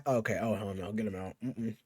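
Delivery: ordinary talk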